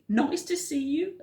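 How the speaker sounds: a welcoming duckbill call; high friendly hoot. A narrator's reading. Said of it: "And they hooted".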